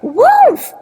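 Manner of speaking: put-on high, panicked little-girl cry; shouting for help. A narrator's reading. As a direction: call out shrilly for rescue.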